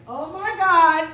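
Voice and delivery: loud, high, drawn-out oh my God; high-pitched exclamation of shock; shocked outburst. Retold or imitated. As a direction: react in stretched-out disbelief. Reaction to learning of cheating.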